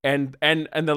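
usual speaking voice

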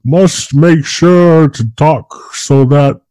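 mock the actors making sure Americans understand them; hammed-up careful movie dialogue. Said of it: "you can tell they're like".

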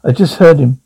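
ordinary talk, nothing marked

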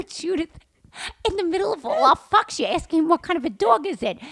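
high-pitched disbelief; retold incredulous protest. To her friend amid the swearing, high incredulous protest. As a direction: question her as if she's lost it.

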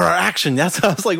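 ordinary talk

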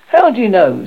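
mildly accusing query; suspicious. A narrator's reading. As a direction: challenge it suspiciously.

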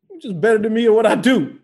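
loud, high exasperated admission; mock grievance. Comedian conceding to his funnier mom, loudly exasperated. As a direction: complain at top pitch, comically beaten. Retold complaint to mother.

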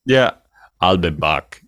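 ordinary talk

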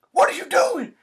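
high, loud alarmed question; retold alarmed teacher, loud and high; alarmed call. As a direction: call out sharply to stop him. Teacher alarmed at open door.

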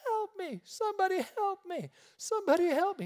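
high-pitched mock cry for help; imitated screaming. Woman bursts into the feed store, imitated screaming for help. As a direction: act out a frantic woman's screams, without full volume. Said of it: "starts screaming".

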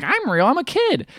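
high, slow imitation of a boy; put-on kid voice. Boy answers the little man, put-on high kid voice. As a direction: pitch it up and draw it out like a simple kid.

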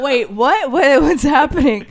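high, loud bewildered cry; laughing, acted panic; mock alarm. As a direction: blurt it out in hammed-up disbelief. Passengers learn of arrest, acted.

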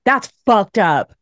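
slow, drawn-out mock lament; slow, put-on voice of a scandalized subject; mock old-timey subject. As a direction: act out a shocked medieval subject. King's subjects react, slow odd voice.

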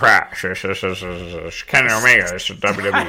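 low drawling commentator voice; J R impression. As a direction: call it like an old ringside announcer. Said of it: "do the J R botch".

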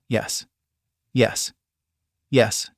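understated groan, with pauses between words. A narrator's reading. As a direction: let each word out as a mild, separate groan. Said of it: "I groaned".

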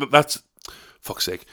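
ordinary talk, nothing marked

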